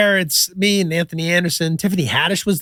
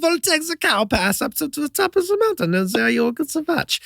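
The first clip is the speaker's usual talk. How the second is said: high, put-on Swiss accent; clumsy Swiss accent, lightly mocking; 7 semitones higher than usual. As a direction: play the Swiss host, pitched up. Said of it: "I love your accent".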